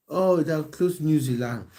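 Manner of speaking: usual speaking voice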